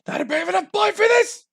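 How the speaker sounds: very high, loud squeal; hammed-up falsetto outburst; mock panic. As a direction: shriek it in comic cowardice. Comic panicked outburst after bold claim.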